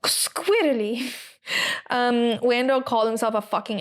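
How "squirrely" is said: SQUIRRELY drawn out slowly, each sound distinct; over-enunciated. Retold or imitated. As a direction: imitate a commentator relishing every letter of "squirrely". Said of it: "really enunciated every letter in the word".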